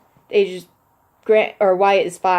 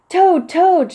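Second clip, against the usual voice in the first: high, loud call across the yard; shouting; 10 semitones higher, 6 dB louder than usual. Acted. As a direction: call out to wake a sleeping friend. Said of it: "shouted Frog".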